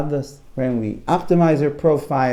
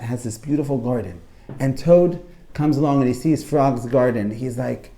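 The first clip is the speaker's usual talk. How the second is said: normal talking voice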